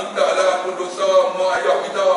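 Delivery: ordinary talk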